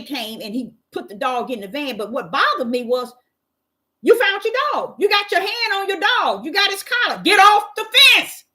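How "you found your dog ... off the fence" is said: high, rising to a loud yell at the end; imagined yell. Retold or imitated. Unspoken rage at the neighbor, building to a loud high yell. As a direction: boss him off the fence in your head. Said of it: "I didn't say it like that".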